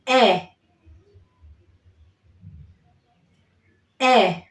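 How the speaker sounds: E said clear like eh; vowel E. Shown as a demonstration. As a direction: model the vowel E. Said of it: "The second one".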